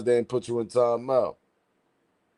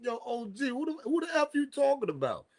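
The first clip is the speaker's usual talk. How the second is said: high mock callout; 14 semitones higher, 7 dB quieter than usual. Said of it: "I'm like".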